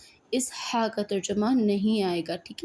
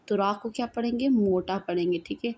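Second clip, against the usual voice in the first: normal talking voice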